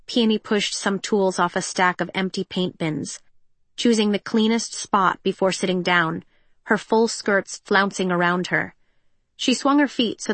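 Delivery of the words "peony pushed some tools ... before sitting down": usual speaking voice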